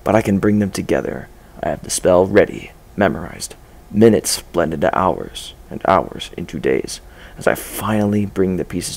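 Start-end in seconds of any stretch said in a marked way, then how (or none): none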